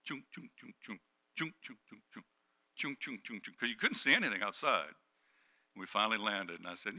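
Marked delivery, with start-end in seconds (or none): 0.0-3.5 quick repeated chugs under the breath; imitated chugging sound. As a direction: chant a soft, steady mechanical chug. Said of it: "just going".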